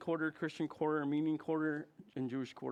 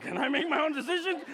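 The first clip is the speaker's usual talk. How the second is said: laughing protest; 11 semitones higher, 7 dB louder than usual. Retold or imitated. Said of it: "I'm like".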